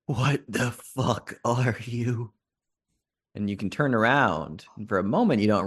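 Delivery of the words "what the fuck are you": slow, hoarse whispered threat. Acted. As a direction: rasp it out slowly, barely voiced. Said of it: "the voice just hoarsely whispers out".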